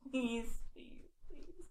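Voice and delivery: breathless whispered pleas; whispered begging. Real, not acted. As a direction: beg in a whisper. Begging softly.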